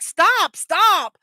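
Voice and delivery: high, loud panicked stop; retold shout at kid. Mom yelling stop at kid driving into bushes. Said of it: "and I'm like".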